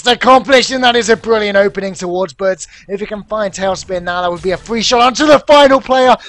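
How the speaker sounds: loud, high esports commentary at full pelt; excited play-by-play. Real, not acted. Commentator calls a possible comeback, loud excited play-by-play. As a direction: shout the clutch play to the audience.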